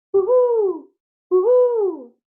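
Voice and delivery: high, loud hooting owl call. Acted. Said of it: "A hoot sounds like this".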